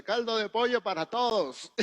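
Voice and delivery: high, loud, cheery aunt voice; excited aunt. Retold or imitated. Preacher imitating his aunt ordering for everyone, high and excited. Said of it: "bien emocionada dice".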